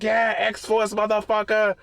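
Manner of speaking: gleeful shouted boast; triumphant yell. Retold or imitated. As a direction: whoop it out like a gloating win. Dude cheers after running villain down.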